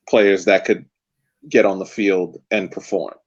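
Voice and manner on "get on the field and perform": ordinary delivery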